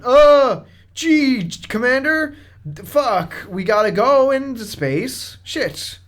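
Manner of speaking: high, hammy bad voice actor; overacted sci-fi line reading; alien voice. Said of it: "You sound like an alien trying to".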